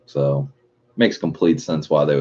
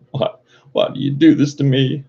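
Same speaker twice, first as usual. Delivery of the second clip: mock lament; 9 semitones higher than usual. Lamenting the empty P O box.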